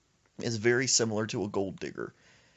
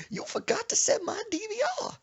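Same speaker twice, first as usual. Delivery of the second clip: high grandma squeal. Retold or imitated. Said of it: "she said".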